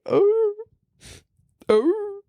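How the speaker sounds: high-pitched mock puppy whine; pained puppy wailing. Fan reacting to the dad's tender line, mock puppy wail. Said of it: "just, like, pained puppy wailing".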